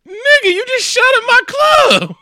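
very high, drawn-out incredulous shout; hammed-up squawk of outrage; outraged yell. Rick to the man who shot at his club, yelling in disbelief.

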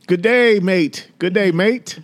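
playful, high Australian-style "g'day, mate"; mock Australian greeting. Husband greeting overseas listeners, mock Australian accent. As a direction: call it out high and jokey, mock Aussie.

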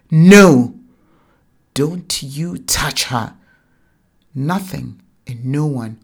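a loud cry of No then firm, loud commands; mother shielding her daughter, shouting; fierce protective shout. Acted. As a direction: bellow to defend the child. Mother shoves husband, protects child.

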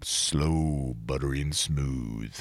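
very low, soft, buttery croon; hammed-up late-night DJ, low and hushed; smooth radio voice. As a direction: pour it out deep and velvety. Joking about his great radio voice.

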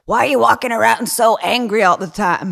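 high, loud mocking impression of an old host; mock Larry King. Imitating Larry King grilling him, high and loud. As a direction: squawk the question high and loud like a fussy old man. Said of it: "and he was like".

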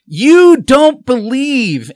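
high, loud, slow accusing jeer; mock accusation. Activists jeering at the candidate, high loud accusing. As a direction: sneer the label at him. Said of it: "and then I'd get".

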